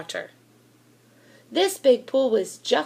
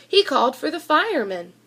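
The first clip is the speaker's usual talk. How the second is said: plain reading voice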